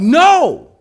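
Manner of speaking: sharp high shout of NO; yelling no. Retold or imitated. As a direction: yell it to stop the child. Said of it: "And I yelled".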